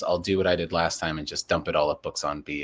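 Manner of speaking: ordinary talk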